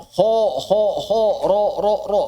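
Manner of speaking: heavy open ho ro, lips not pushed out; open thick ho. Shown as a demonstration. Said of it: "Bukan, tapi dibuka". (ho dan ro tebal, mulut dibuka)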